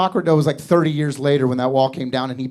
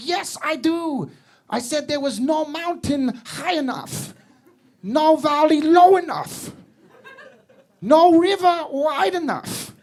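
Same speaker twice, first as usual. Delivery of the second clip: sheepish husband; 10 semitones higher, slower than usual. Retold or imitated. Husband recites vows slowly, high.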